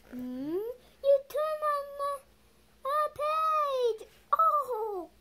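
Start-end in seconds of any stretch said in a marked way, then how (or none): 1.0-5.1 much higher, louder alarmed cry; dismayed high yelp. Acted. Grover to reader, alarmed that the page turned. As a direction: shriek that the page got turned.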